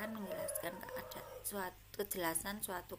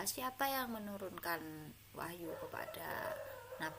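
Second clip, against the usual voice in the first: ordinary talk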